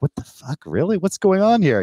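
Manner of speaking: outraged disbelief. Retold or imitated. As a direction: builds from shocked to near-shout. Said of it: "And I'm like".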